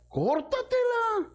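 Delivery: very high, sharp command; Jesus commanding. Acted. Jesus telling the crowd to pluck out the eye, stern command. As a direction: cry it out as Jesus' stern order. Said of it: "Que dijo".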